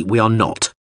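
usual speaking voice

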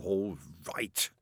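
whispered, miserable give-in; a sulky unvoiced surrender; wretched whisper. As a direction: breathe it out defeated. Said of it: "said Crowley wretchedly".

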